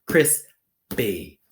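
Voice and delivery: CRISPY split into two beats, CRIS, PEE, first one loud; pounded-out syllables. Shown as a demonstration. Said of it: "So let's pound it".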